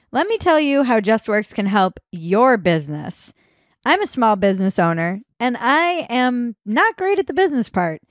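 upbeat sponsor pitch to small businesses; lively, carefully phrased host-read advert; podcast ad read. Acted.

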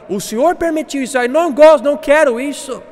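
high-pitched protest, rising in force; blaming complaint. Acted. Believer blaming God for what happened, complaining loudly. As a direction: protest to God as if He were to blame. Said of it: "para culpar ele, fala".

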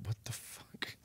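soft unvoiced swear; whispered curse. Real, not acted. Streamer whispers a curse at the app. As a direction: whisper it in disbelief.

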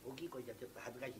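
very soft, low and quick; bashful low voice. Acted. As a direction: shyly shrink from speaking up. (恥ずかしそうに声をひそめて早口で)

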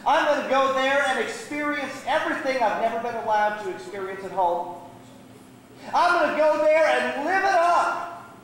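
high-pitched, eager bragging; excited boasting. Acted. Runaway telling brother his Vegas plans, excitedly boasting. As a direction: revel in the freedom ahead.

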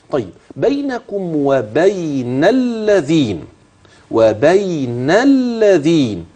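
الذين slow with a doubled light lam; الذين بلام مشددة مرققة. Shown as a demonstration. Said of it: "إن اللام أدغمت في اللام".

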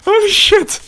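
high loud startled cry; shocked exclamation. Real, not acted. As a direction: blurt it in shock. Shocked in game.

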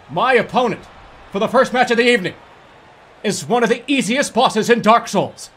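loud, hyped boxing-announcer voice; ring announcer. Acted. Streamer introducing his Pinwheel match, loud hammed-up ring announcer. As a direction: introduce the fighter to the crowd.

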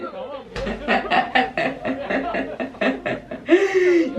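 long helpless wheezy laugh; laughing hard. Real, not acted. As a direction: keep laughing until it trails off. Reacting to funny clip. (uzun uzun kahkaha atma)

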